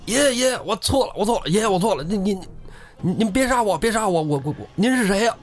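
high-pitched stammering plea; acted panicky begging from a beaten robber; begging for mercy. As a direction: grovel in a high voice, tripping over repeats. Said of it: "赶紧求饶".

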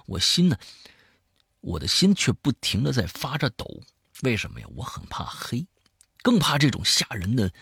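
lively storyteller swinging between hushed and emphatic; spoken horror-story narration; dramatic storytelling. A narrator's reading. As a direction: tell a creepy tale with punched key words. Tells a horror story aloud.

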